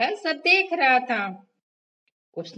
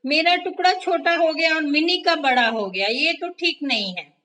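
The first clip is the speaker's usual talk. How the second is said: protesting mouse; 6 semitones higher, 5 dB louder than usual. A narrator's reading. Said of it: "टिनी फिर कहने लगी".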